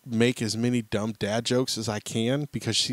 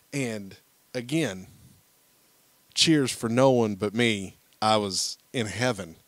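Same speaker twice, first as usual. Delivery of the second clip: normal talking voice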